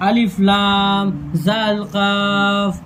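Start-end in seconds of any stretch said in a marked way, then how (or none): none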